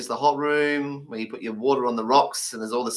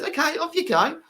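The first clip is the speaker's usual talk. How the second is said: lightly voiced British guy. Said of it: "British guy goes".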